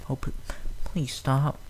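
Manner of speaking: gingerly mumbled. A narrator's reading. Fluttershy begging the crying fillies, soft and teary. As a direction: plead softly near tears. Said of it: "gingerly mumbled".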